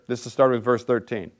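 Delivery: ordinary talk, nothing marked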